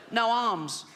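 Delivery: put-on high, funny little boy voice; comic put-on child with a bad chest; Timmy. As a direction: answer as a sickly small child. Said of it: "a funny little voice".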